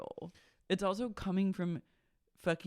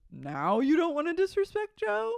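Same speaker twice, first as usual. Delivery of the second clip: high, louder sarcastic question; scoffing Lala; 12 semitones higher, 5 dB louder than usual. Retold or imitated. Lala mocking Schwartz's sudden respect for Joe, high sarcastic. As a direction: throw it back at him mockingly.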